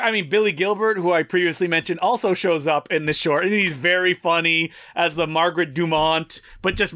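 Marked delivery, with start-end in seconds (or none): none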